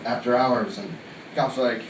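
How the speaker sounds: ordinary delivery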